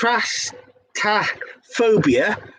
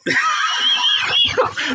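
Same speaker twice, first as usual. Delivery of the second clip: shrieking laugh. Real, not acted. After guessing snowballs, she shrieks with laughter.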